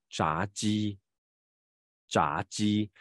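炸鸡 slowly, 鸡 made with the tongue surface; teacher's model 炸鸡, j not on the tongue tip; tongue-surface consonant. Shown as a demonstration. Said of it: "其实是舌面音啊".

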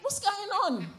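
high, loud mock-shocked outcry; hammed-up alarm at the map; shocked what's going on. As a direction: blurt it out in retold disbelief. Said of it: "I said".

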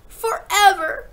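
high, loud, drawn-out wail; stretched, high outburst; anguished cry. A narrator's reading. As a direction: cry it out slowly as a distraught child. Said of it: "Matilda cried".

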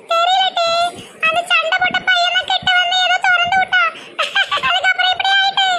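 put-on very high giggly girl; mad laughter. Daughter blaming the boy she fought with, laughing madly. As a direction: explain it between helpless bursts of laughter. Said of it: "பைத்தியம் மாதிரி சிரிக்கா".

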